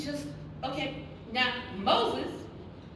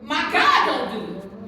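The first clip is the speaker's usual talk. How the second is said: defiant shout; 9 dB louder, slower than usual. Acted. Preacher mocks giant's defiance.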